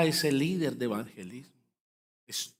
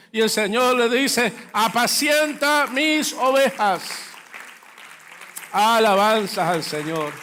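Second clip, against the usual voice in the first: louder, higher preaching climax; preaching at full force; 8 semitones higher, 7 dB louder than usual. Acted. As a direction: build the story to a fervent peak. Climax of the sermon story.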